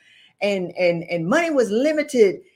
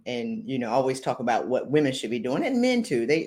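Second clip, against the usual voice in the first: normal talking voice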